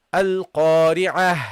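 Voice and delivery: "Al-Qari'ah" drawn out slowly, ending in h; pausal ha ending. Shown as a demonstration. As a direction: recite "Al-Qari'ah" with long vowels and a breathed final h. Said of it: "you have to pronounce the ha".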